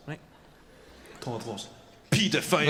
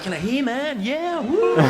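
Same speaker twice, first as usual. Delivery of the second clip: put-on high, excitable indie-kid sales assistant; over-enthusiastic shop assistant; 11 semitones higher, 5 dB louder than usual. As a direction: bounce in with mock enthusiasm, pitched right up to a whoop. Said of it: "that kind of energy and enthusiasm".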